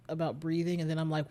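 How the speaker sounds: normal talking voice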